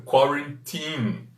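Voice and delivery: put-on quarantine said slowly, kwa-ren-TEEN; careful slow quarantine with English vowels. Shown as a demonstration. Teacher modelling quarantine slowly for the live audience. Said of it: "pronunciar quarantine".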